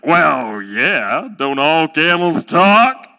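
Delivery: low, slow, matter-of-fact camel voice; talking camel. Acted. Camel to shocked owner, slow and unbothered. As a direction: draw it out low, as if obvious.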